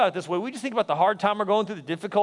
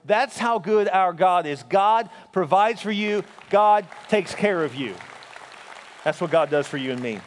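preaching emphasis. Acted.

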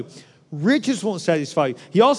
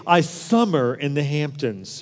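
lower, slow, lofty rich-people voice; mock the rich, drawn out and low; snooty rich person; 7 semitones lower, 3 dB quieter than usual. Preacher mocks rich people's talk, lofty and slow. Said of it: "they say nouns like they're verbs".

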